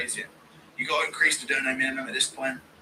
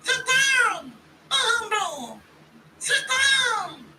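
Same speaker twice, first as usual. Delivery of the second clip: high falsetto chant climbing higher; much slower than usual. Retold or imitated. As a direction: chant the hook in a squeaky high voice. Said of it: "Impression of [name] saying".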